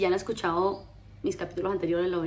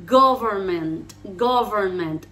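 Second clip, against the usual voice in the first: "government" slow and firmly stressed at the start; deliberate teacher's "government"; slow model word; 5 dB louder, much slower than usual. Shown as a demonstration. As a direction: say "government" slowly for learners. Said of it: "en realidad no es tan difícil".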